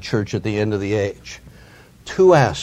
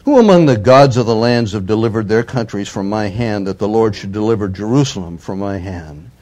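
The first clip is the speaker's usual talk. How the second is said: loud, declaiming the envoy's taunt; forceful scripture reading; 6 dB louder than usual. Acted. Assyrian envoy taunting Jerusalem, read out loud and forceful. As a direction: proclaim it like a herald's challenge.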